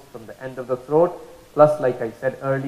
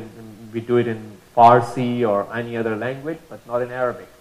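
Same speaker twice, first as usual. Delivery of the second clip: normal talking voice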